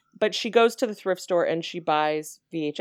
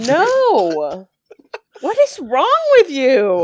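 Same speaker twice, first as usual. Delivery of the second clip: much higher, louder, slow disbelief; appalled exclamation; 7 semitones higher, 9 dB louder, much slower than usual. Real, not acted. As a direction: recoil in disgust at a friend. Reacting to a gross suggestion.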